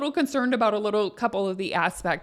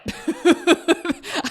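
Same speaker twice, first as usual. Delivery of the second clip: quick high giggle; a little embarrassed giggle, higher; short laugh; 7 semitones higher, 4 dB louder than usual. Real, not acted. Laughs at the drug joke.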